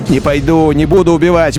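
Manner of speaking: ordinary talk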